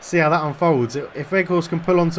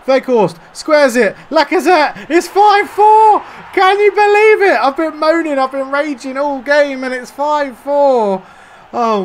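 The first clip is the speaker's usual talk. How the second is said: much higher, much louder, elated yelling; ecstatic goal shout; 11 semitones higher, 9 dB louder, slower than usual. Real, not acted. Gamer celebrating a late winner, shouting in joy. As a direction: scream the winner with relief and joy.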